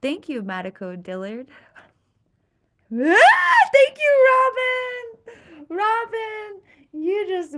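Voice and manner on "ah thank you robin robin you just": much higher, loud delighted cry; excited thanks. Real, not acted. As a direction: squeal with joy at the gift. Thrilled thanks to a viewer.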